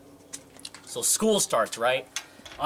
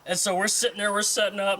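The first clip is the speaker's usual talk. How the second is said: ordinary delivery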